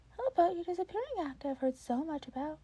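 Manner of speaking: bright eager offer from a cheerful mentor; Nezu's voice. Acted. Nezu voiced by reader.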